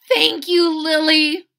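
put-on squeaky little-boy gratitude; grateful boy. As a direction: Chester thanking Lily, high and loud. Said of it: "said Chester".

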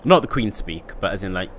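ordinary delivery

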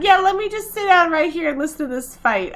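hint of an incredulous stand-in voice; voicing the Sim woman. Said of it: "she's like".

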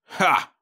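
short scornful mock laugh; a single barked ha of contempt; harsh laugh. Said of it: "Crumbine laughed harshly".

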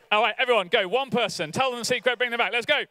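high, energetic rallying call; excited rallying. Real, not acted. Preacher sends the whole crowd out, high and excited. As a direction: fire them up and send them off.

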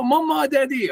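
high, mock child's cry. Child calling her parents after burning her hand. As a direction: whimper like a hurt little girl. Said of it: "and she cries".

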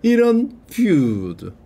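slow, drawn-out 이런 feud; slow pun delivery; slow punny reading. Shown as a demonstration. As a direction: say 이런 feud slowly like a joke. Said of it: "pun reading of feud".

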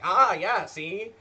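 loud, punchy 1920s newsman; old-timey reporter voice. Retold or imitated. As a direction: bark it like an old newsreel reporter. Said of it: "his old-timey reporter voice".

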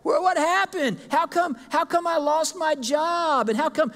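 high, slow, complaining voice of a believer who lost a job; whining believer. Retold or imitated. A churchgoer complaining to God about losing a job, whining. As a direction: wail like someone who just lost their job. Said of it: "We go".

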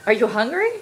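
ordinary talk

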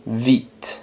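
vite with a buzzed V, softly said "veet"; labiodental V. Shown as a demonstration. Teacher models the word, vite with voiced V. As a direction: start vite with teeth on lip, end on T. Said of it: "se pronuncia V".

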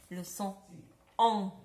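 the nasal vowel en held open and clear; nasal en sound. Shown as a demonstration. Teacher modelling en to children, nasal. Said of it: "Ça vous fait penser un peu au son on".

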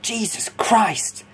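high, drawn-out mock shout; mock yell at held-back volume; retold shout. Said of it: "He just shouted out".

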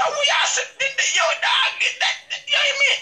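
excited shout. Retold or imitated. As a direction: squeal it out, thrilled and shouting. Man reacts excitedly on phone.

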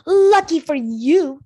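a hint of a haughty queen snapping; snapped. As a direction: bite off each word in irritation. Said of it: "Snowfall snapped".

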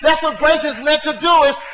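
loud, driving preacher's exhortation; fiery preaching. Acted. Pastor urging the church to pray before Sunday, loud preaching. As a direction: push it hard at the congregation.

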